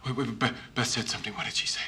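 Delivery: a sudden quiet as it dawns on him; hushed realization. Acted. Husband catching his wife's words on the tape, hushed.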